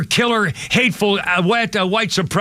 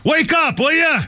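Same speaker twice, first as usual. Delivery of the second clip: higher, urgent plea to listeners; exasperated call; 8 semitones higher than usual. Real, not acted. Radio host to listeners, higher exasperated plea. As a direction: shake them awake. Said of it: "host pleading with listeners".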